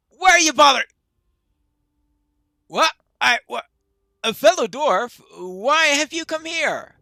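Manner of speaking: high, loud burst of alarm from a dwarf; startled dwarf shouting up high; startled challenge. Acted.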